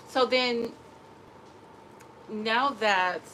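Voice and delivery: usual speaking voice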